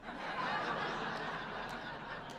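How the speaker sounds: long airy laugh; breathy laugh. Real, not acted. Laughs at ad mistake.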